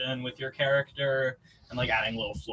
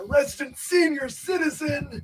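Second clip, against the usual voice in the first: put-on high, loud shaky old-timer voice; old person voice; 13 semitones higher, 7 dB louder than usual. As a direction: play it like a creaky old grandpa. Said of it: "I like doing the old person voice".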